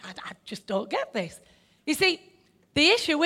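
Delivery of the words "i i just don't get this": much softer, under the breath; muttered thought. Retold or imitated. Preacher voices his own baffled inner thought, muttered. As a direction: mutter it to yourself. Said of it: "muttered inner thought".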